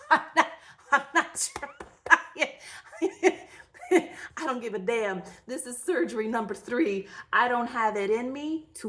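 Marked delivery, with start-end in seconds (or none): 0.1-4.1 high, laughing through the words. Real, not acted. Telling her surgery story, laughing helplessly. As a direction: giggle it out.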